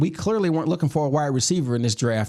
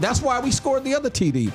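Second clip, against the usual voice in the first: ordinary talk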